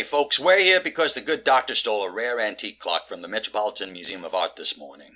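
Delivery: normal talking voice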